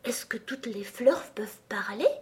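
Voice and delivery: shy whispered question; timid, very low voice. A narrator's reading. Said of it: "d'une voix timide et très basse". (chuchotement timide)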